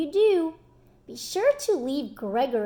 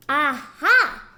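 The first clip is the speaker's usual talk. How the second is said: triumphant cry; 9 dB louder than usual. Acted. Storyteller to children, collector woman's loud triumphant cry. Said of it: "cried the collector woman".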